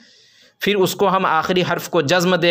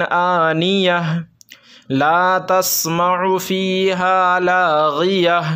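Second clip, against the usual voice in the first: slow melodic tajweed recitation; Quran recitation; much slower than usual. Acted. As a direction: recite each verse slowly, in measured chant. Reads Quran verses melodically. (धीमी, सुरीली क़ुरान तिलावत)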